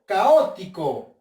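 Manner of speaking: "caótico" slow and loud on Ó: ca-Ó-ti-co; drawn-out, loud second syllable in "caótico"; stress on Ó. Shown as a demonstration. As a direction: hit the "ó" of "caótico" hard. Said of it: "Con la o".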